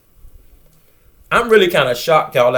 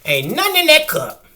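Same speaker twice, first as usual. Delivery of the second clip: mock doubter; 11 semitones higher than usual. Echoing the doubting viewer.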